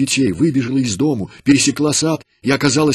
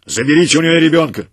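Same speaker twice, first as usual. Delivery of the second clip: higher, loud barked commands; rough orders; 4 semitones higher, 7 dB louder than usual. A narrator's reading. Commander barking orders at his soldiers, high and loud. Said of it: "грубо ответил начальник отряда".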